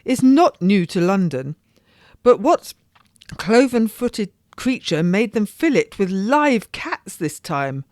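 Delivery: a lady declaring outrage; indignant lady. Acted. Lady telling her friend of the wicked procession, indignant.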